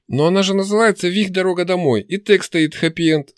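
high-pitched indignant objection. A narrator's reading. Objecting to the book's title, indignant. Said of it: "сказал он с негодованием".

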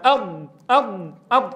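أض with the tongue sealed and the air trapped; sealed dad demo. Shown as a demonstration. Teacher showing students the closed أض. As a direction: hold أض shut, no air escaping. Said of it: "هل يخرج الهواء".

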